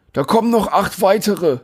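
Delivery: high, winded delivery man gasping between words; a sweaty delivery man, out of breath from the stairs; panting. A narrator's reading. Delivery man to narrator at the door, panting and winded. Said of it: "keucht er".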